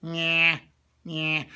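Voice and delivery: loud nasal bleat; Bugs Bunny. Shown as a demonstration. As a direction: block the mouth, send the sound through the nose. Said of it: "mostly coming through your nose".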